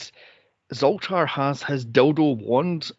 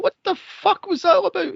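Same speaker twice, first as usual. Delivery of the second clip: incredulous outburst. Retold or imitated. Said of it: "I'm like".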